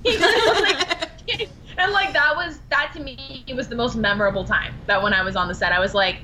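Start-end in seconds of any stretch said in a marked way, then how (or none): 0.1-1.5 high, slow laughing. Real, not acted. Recalling a castmate's bagel gift, laughing. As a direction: laugh through it delightedly.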